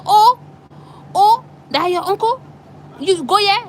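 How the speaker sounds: high, loud startled cry of oh, oh; startled officer. Retold or imitated. Officer hears her uncle's name.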